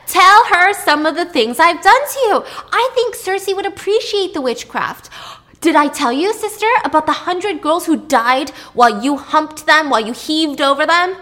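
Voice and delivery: shrieking high, loud counterattack; riled up. Retold or imitated. Pasiphae exposing her husband to her sister, shrieking. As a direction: leap in and scream the accusations back. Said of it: "she was riled up".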